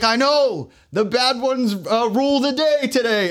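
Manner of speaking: mock king. Voicing the king's reply.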